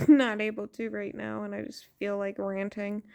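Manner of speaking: ordinary talk, nothing marked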